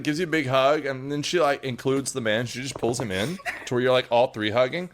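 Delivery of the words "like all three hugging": ordinary delivery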